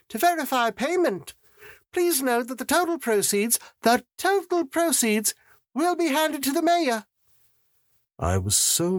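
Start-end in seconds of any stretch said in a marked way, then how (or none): 0.1-7.1 sustained high-pitched coo. A narrator's reading. Auctioneer telling bidders where proceeds go, cooing. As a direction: coo the announcement, pitched far up. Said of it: "she cooed".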